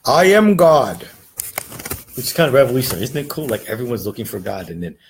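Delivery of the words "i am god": louder, higher self-proclaimed god; mock proud claim. Spiritual teacher mocking believers, in a loud grand claim. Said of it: "many people are like".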